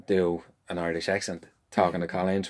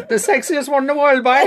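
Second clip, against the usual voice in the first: put-on much higher, laughing Cork accent; 10 dB louder than usual. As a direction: ham up a Cork accent, louder. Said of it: "It's not the Cork accent".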